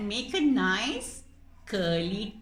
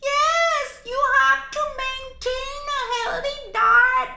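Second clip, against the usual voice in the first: much higher, loud scolding squeak; squeaky lecturing mouse; 8 dB louder than usual. Acted. Mouse puppet scolding the cat about its diet, squeaky.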